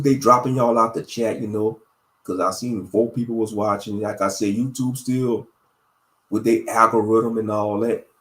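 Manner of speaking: ordinary talk, nothing marked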